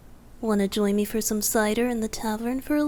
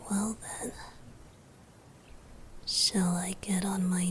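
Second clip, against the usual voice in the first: intimate whisper; 2 semitones lower, 5 dB quieter than usual. Acted. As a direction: breathe every word close to the ear.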